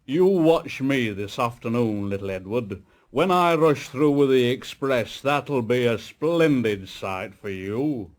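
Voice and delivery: a touch of pompous boasting; lightly voiced grand engine; proud big engine. A narrator's reading. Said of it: "very big and very proud".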